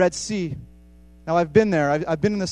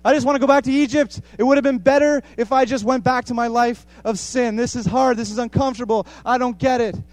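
raised, louder and higher despairing complaint; loud complaining cry; 6 semitones higher, 6 dB louder than usual. Retold or imitated. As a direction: wail it out like a frightened crowd. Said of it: "And they cry out and they say".

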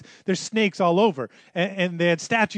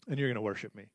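hushed; 8 semitones lower, 11 dB quieter, much faster than usual. Acted. God's promise, softly intoned.

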